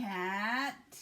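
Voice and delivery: ordinary talk, nothing marked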